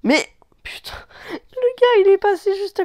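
loud high frustrated cry; exasperated outcry. Real, not acted. Exasperated at a teammate who missed the kit. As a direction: yell in disbelief.

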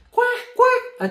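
high, odd-timbre quack; squeaky cartoon duck quack. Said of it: "أتوقع هكذا". (صوت بطة كرتوني)

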